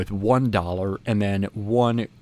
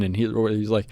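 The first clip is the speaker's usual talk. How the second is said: usual speaking voice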